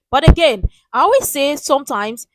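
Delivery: emphatic loud restart of the point. Real, not acted. Loud restart after musing.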